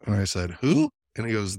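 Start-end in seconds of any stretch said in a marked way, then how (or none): 0.6-0.9 high, loud, startled question; shocked. Retold or imitated. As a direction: yelp it out in disbelief. Retelling shock at "the Pygmy people.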